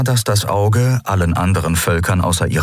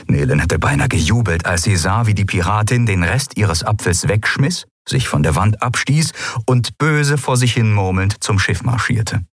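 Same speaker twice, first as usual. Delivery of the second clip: ordinary delivery, nothing marked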